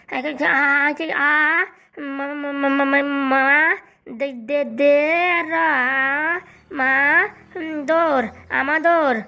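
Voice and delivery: sounding out each syllable slowly, stuck; a grown man struggling to read letter by letter; halting spelled-out reading. Acted. Husband sounding out his schoolbook syllable by syllable. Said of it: "বানান করে পড়তেছিস, তা উচ্চারণ করতে পারিস না".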